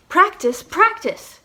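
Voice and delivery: emphatic drilling voice; insistent. Shown as a demonstration. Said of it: "I really gotta drive home the point".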